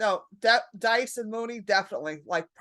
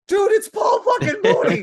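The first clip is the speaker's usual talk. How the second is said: put-on high, loud fan-girl shout at a friend; squealing much higher and louder, beside herself; starstruck shout; 13 semitones higher, 8 dB louder than usual. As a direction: yell it like you just spotted your hero. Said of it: "And I'm like".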